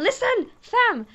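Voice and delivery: high, loud exasperated tag; fed-up slang punch; exasperated fam. Real, not acted. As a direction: throw up your hands at it. Frustrated about school not listening.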